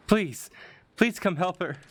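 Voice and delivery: high, urgent plea for a friend; pleading woman. Acted. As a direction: beg for help in a high put-on voice. Voicing a woman pleading for help.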